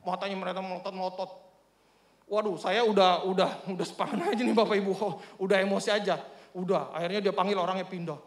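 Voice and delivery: ordinary delivery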